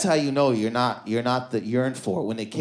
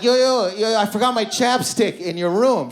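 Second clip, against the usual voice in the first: high, loud stalling plea; urgent bargaining; 8 semitones higher, 5 dB louder than usual. Retold or imitated. Man at the door bargaining to stay, urgent plea. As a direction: call out a desperate mock excuse.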